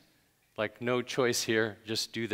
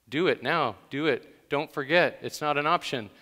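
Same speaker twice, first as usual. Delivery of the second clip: loud, insistent, punched orders; emphatic command; 3 semitones higher, 6 dB louder than usual. Shown as a demonstration. Preacher voices the verb's force to listeners, emphatic command. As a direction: press each short order home. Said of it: "every time it's an emphatic verb".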